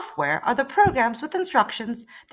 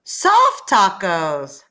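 very slow, louder drawn-out answer; grand reveal; 2 semitones lower, 6 dB louder, much slower than usual. Acted. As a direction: savour the answer like a prize. Second reveal.

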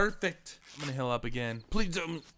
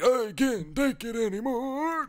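slow, drawn-out, higher mock wail; imitated breakdown; 4 semitones higher, 4 dB louder, slower than usual. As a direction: act out someone at the end of his rope. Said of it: "He's like".

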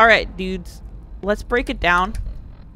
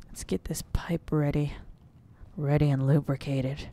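whisper; 10 semitones lower, 12 dB quieter than usual. Acted. Sneaking up in game.

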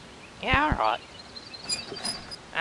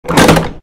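startled gasp; 14 dB louder than usual. Acted. Startled on set.

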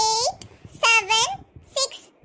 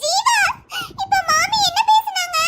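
put-on much higher, childlike whine; child voice; 9 semitones higher than usual. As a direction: play the little girl, woken and curious. Wife again plays the child.

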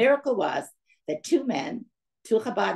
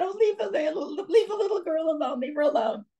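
much higher, relenting voice with a laugh; relenting conductor; 11 semitones higher than usual. Retold or imitated. Conductor telling the mother to leave the little girl alone, higher and relenting. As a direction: give in, half laughing.

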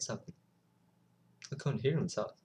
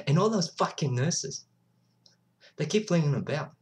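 raised, heated complaint about the nurses; higher, louder, indignant outburst; angry rant; 4 semitones higher, 5 dB louder than usual. Acted. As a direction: vent loudly with anger.

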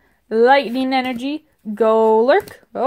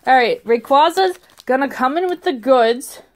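ordinary talk

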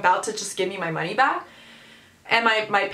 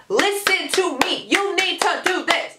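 high, slow, hammered-out mock demand; bossy demand; 7 semitones higher, slower than usual. Imagined telling-off of Taco Bell staff, bossy and exaggerated. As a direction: act out a pushy customer, word by word. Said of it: "kind of person who's gonna be like".

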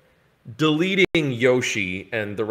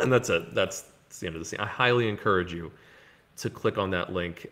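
ordinary delivery

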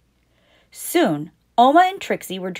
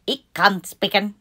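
Dutch toy voice. Acted. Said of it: "It could speak in Dutch".

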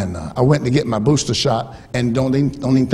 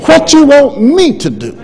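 loud slow plea; 12 semitones higher, 7 dB louder, slower than usual. Shown as a demonstration. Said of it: "Let's practice".

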